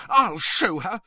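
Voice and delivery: high, loud, furious; shaking with rage. A narrator's reading. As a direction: spit out the threat in rage. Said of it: "shaking with rage".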